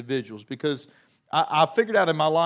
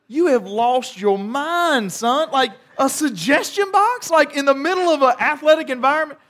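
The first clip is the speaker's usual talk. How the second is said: much higher and louder, mocking disbelief; incredulous disbelief; 9 semitones higher, 5 dB louder than usual. Pastor mocking the league's suggestion box, incredulous. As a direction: scoff as if it's the craziest idea ever.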